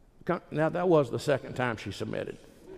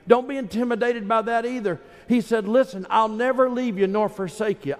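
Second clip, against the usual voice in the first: higher, ringing preacher's cadence; full-force preaching; 12 semitones higher than usual. Acted. Preacher urging the congregation not to be intimidated, ringing. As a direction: lift the congregation with conviction.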